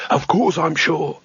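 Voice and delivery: slow, mild snarl. A narrator's reading. Leader snaps at the youngest wizard, a mild snarl. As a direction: bite the words at him. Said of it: "snarled the leader".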